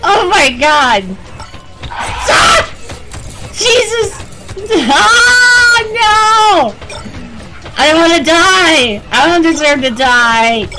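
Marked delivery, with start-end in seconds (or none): 1.9-2.6 sudden high shriek of fright; real startled scream. Real, not acted. Player jumps at a monster, real scream. 4.9-6.7 high, loud scream of no; desperate yell; panicked shout. Real, not acted. Player about to be caught, panicked shout. Monsters catching up. 7.8-10.7 begging to survive; desperate plea. Real, not acted. Player running from monsters, desperate plea. Fleeing in panic.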